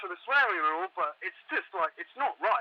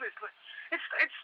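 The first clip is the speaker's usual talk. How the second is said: frightened whisper; 10 semitones higher, 5 dB quieter than usual. Acted. Caller breaking down to the radio host, terrified whisper. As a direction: breath-only, voice dropping out in fear. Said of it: "I can appreciate your fear".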